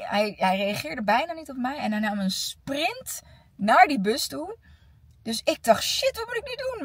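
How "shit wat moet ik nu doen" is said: panicked. Retold or imitated. Retold panic as pupil sprints off.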